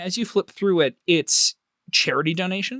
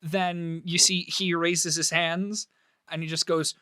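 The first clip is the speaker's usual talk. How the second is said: ordinary talk, nothing marked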